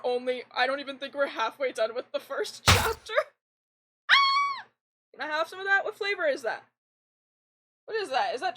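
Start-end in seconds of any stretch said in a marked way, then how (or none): 4.1-4.7 sharp high loud yelp; startled yelp. Real, not acted. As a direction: yelp as something hits you. Item falls on her head.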